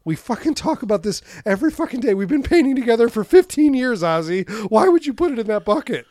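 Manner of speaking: mock angry scolding.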